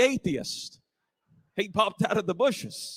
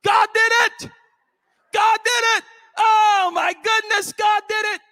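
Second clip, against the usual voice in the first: high, loud jubilant hollers, voicing the woman; shouting even louder; 9 semitones higher, 8 dB louder than usual. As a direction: drown out the doubter with praise. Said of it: "she started shouting even louder".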